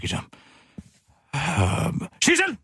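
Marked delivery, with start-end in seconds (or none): 2.2-2.6 high sharp call across the room. Acted. Boss calling across the office for Sissel. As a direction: call her name loud and high.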